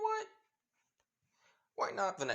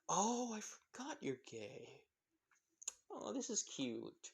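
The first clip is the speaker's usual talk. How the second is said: high, slow, cooing delight; slow, high gushing over a friend's crush; 7 semitones higher, 5 dB quieter, slower than usual. Acted. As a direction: coo over it like it's adorable. [name] gushes over the crush.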